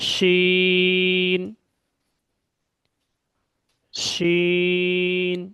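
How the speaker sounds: long held "শিন", hissing sh at the teeth; drawn-out Arabic letter. Shown as a demonstration. Teacher showing students the letter, slowly held. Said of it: "দন্তের স্বর মতন উচ্চারণ হবে".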